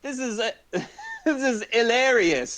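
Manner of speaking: high-pitched mock English accent, breaking into laughter. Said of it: "do an English accent".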